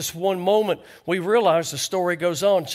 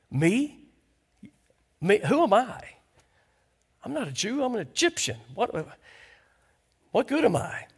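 higher-pitched, questioning self-doubt; halting protest in a raised pitch; incredulous Hagar; 4 semitones higher than usual. Acted. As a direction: lightly act out a bewildered "who, me?". Acting out Hagar answering God.

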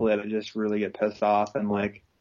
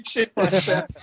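ordinary delivery

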